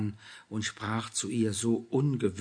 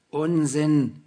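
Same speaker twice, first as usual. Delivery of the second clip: higher, louder, rough dismissal; gruff; 5 semitones higher, 10 dB louder than usual. A narrator's reading. Julia brushing off Anna's objection, gruff and loud. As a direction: brush her off sharply. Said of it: "widersprach ihre Freundin ruppig".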